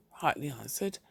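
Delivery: plain reading voice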